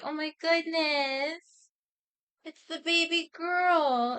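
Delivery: high, slow, drawn-out amazement; hammed-up doting astonishment; acted parental gushing. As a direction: gush over a baby, stretching the words. Dads gush over daughter speaking.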